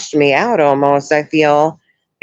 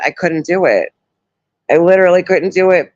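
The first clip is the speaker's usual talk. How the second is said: usual speaking voice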